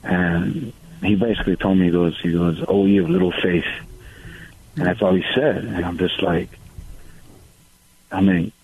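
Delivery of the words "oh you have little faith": ordinary talk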